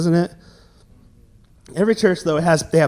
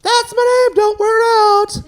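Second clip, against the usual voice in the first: put-on cocky kid shout; 14 semitones higher than usual. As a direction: belt it out to the teacher. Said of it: "And I said".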